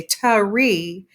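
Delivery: "tree" split into tuh-REE; mistaken two syllables. Shown as a demonstration. Teacher warning of a mistake, tree exaggerated as tuh-ree. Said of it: "But here, if we say tari".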